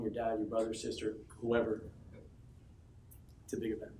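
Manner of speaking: ordinary talk, nothing marked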